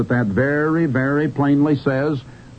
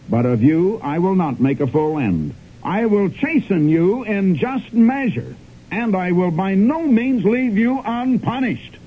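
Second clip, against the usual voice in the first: slow, higher, grave reading of scripture; solemn scripture reading; 4 semitones higher, slower than usual. Acted. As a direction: pronounce the verdict slowly and gravely.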